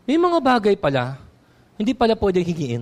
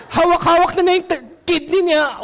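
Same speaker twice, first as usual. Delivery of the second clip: mock dying man; 8 semitones higher than usual. Mock clutching kidney, dying.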